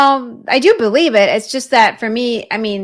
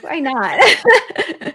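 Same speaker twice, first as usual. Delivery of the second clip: laughing; 7 semitones higher, 3 dB louder than usual. Real, not acted. Laughing while answering.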